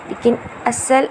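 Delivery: ordinary delivery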